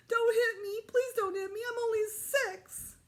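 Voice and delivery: high, pleading, near tears; crying. Acted. Little boy begs the bully not to hit, crying. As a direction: beg like a crying little kid. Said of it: "Daniel started to cry".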